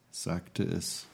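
ordinary delivery

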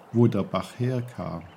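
plain reading voice

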